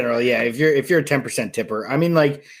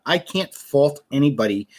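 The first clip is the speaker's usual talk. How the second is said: normal talking voice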